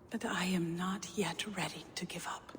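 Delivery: eerie breathy whisper; a secretive, otherworldly whisper. Acted. Gunnlodr whispering to Havi about old magic, eerie.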